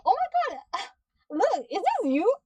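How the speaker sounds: high, mocking squeal of the clueless character; high mock voice, laughing; mocking high-pitched imitation. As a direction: mimic him in a shrill high squeal. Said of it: "then say".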